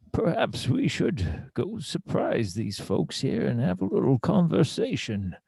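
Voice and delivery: slow, swooping in-character delivery; theatrical adventurer. Acted. Kane proposes a conversation to the party, slow and theatrical. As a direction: stretch it out grandly with a rolling tune.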